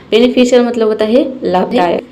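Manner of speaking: normal talking voice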